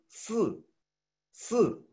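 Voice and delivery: "si" with the i almost silent, high; Mandarin sì. Shown as a demonstration. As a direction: say "si" barely voicing the i. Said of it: "muda la I".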